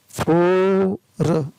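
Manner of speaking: फोर sounded out slowly, long "oh", then a separate "r". Shown as a demonstration. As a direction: hold the ओ in फोर before the र. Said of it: "ओ लंबा पड़ा जाता है".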